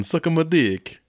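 high, loud mock Italian accent; hammed-up Italian accent. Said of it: "as good as my, um, Italian".

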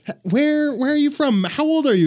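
mother's voice. Retold or imitated. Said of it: "And she's like".

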